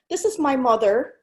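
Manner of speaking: normal talking voice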